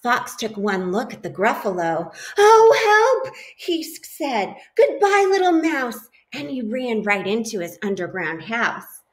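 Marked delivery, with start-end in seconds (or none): 2.4-4.5 very high, loud frightened cry; mock alarm. Snake sees the Gruffalo, cries out in mock fright. 4.7-6.1 high, hurried fearful farewell; nervous goodbye. Acted. Snake flees the Gruffalo with a high nervous goodbye. Fox fleeing.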